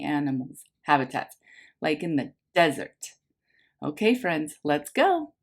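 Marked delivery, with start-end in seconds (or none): none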